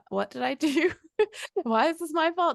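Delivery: hammed-up panic over a blunt text; laughing in mock alarm at her own overreaction.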